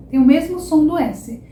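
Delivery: ordinary talk, nothing marked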